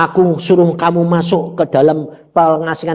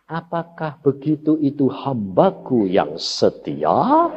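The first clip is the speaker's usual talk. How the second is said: slow, solemn divine question; voice of God; 2 semitones lower, 4 dB quieter, slower than usual. Retold or imitated. God questioning his grumbling servant, slow and solemn. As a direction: weigh each word like God testing his servant. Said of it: "Dia ngomong apa?".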